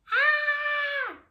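high loud hammed-up scream. The pea crying out as he is caught, high and loud. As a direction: yell as he falls.

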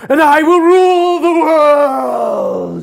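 drawn-out triumphant roar; villain shout. Pretend. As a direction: hold it long and bellow. Climax of villain rant.

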